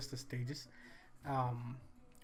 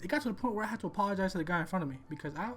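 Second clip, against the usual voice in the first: ordinary talk, nothing marked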